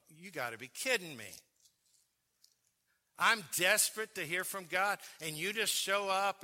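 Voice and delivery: inner yelling. Retold or imitated. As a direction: yell at the stammering preacher in your head. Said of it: "inside I'm yelling".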